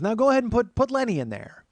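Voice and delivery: high, loud imitated aunt; bossy aunt. Aunt telling nephew whom to add, bossy aunt voice. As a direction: boss him around as the aunt.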